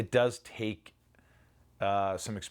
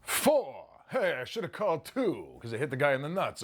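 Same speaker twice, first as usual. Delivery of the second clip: Rodney Dangerfield voice; 7 semitones higher than usual. Retold or imitated. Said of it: "he says a joke".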